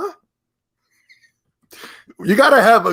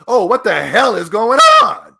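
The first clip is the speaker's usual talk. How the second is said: higher, louder imitated outburst of shock; mock shocked exclamation; 6 semitones higher, 6 dB louder than usual. Descendants of enslaved people reacting to Microsoft, mock shocked outburst. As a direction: act out his people's bewildered reaction. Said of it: "are going".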